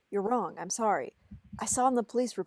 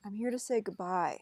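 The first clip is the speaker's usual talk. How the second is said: ordinary delivery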